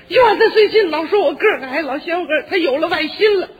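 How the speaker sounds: hammed-up teary grumbling about a rival; mock jealous wife. Wife's jealous complaint retold.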